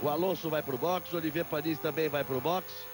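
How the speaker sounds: excited live race call, pitch up; motorsport commentator. Real, not acted. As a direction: call the fastest lap with rising excitement. Excited lap-time call. (narrador de Fórmula 1 empolgado)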